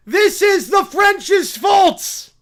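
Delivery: high, loud, slow accusation; shouted blame. Acted. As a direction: pound out the blame theatrically. Acted character blames the French.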